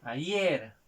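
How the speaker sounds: "ayer" stressed on its final syllable, yer; stress on last syllable. Shown as a demonstration. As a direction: punch the second syllable of "ayer". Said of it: "en la última sílaba". ("ayer" con la mayor fuerza de voz en yer)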